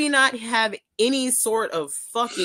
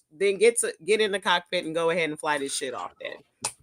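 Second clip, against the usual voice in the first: ordinary talk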